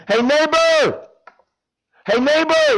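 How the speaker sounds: high, loud call up to a window. Acted. Man at midnight calling up to his neighbor's window, loud high call. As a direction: shout up to wake the neighbor at night.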